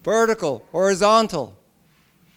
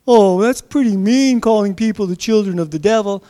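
higher, louder protest as an offended listener; mock objector; 4 semitones higher, 6 dB louder than usual. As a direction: voice a scandalized objection. Voices an imagined objector's protest.

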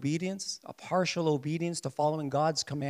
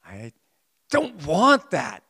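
the flesh protesting; 3 semitones lower, 5 dB louder than usual. Pretend. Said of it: "my one side says".

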